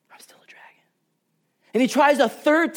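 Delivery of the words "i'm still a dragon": hushed, breathless whisper of defeat; whispered despair. Retold or imitated. Eustace whispers that he is still a dragon, in despair.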